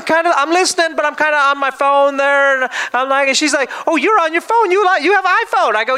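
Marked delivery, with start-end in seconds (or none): 3.9-5.7 higher, lightly voiced older woman; a hint of a sweet older lady. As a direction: imitate the chatty lady beside you. Pastor voicing old lady on plane.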